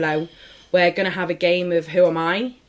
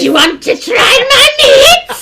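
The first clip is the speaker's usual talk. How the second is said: high, loud spooky voice through laughter; 12 dB louder than usual. Shown as a demonstration. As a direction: do the spooky voice, hammed up. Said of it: "Go on, do the voice".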